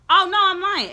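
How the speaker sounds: high, loud burst catching her own mistake; sudden correction. Real, not acted. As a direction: yelp it as you catch the slip. Sudden self-correction mid-list.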